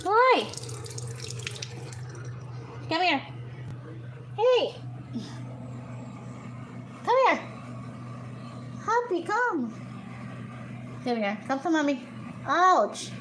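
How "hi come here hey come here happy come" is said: coaxing a bird. Real, not acted. Coaxing the cockatiel to come.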